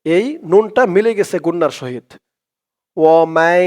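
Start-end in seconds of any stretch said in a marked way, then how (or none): 3.0-3.7 slow, drawn-out chanted recitation; Quran recitation. Shown as a demonstration. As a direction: recite it slowly, stretching each vowel. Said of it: "recites the example".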